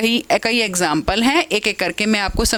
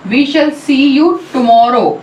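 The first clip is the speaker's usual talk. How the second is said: slow and louder, rising on "tomorrow"; 2 semitones higher, 6 dB louder, much slower than usual. Shown as a demonstration. Teacher demonstrating rising tone in a statement-question. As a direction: let "tomorrow" climb like a question. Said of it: "symmetry rising tone".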